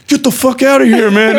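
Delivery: high, loud shouted threat; hammed-up angry guard yelling; mock guard shout. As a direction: bark them away from the gate, high and loud.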